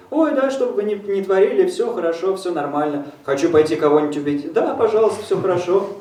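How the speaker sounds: high, sing-song pushover; doormat voice. Retold or imitated. Interpreter mocks a doormat, high sing-song agreement. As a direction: agree with everything sweetly. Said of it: "половым ковриком".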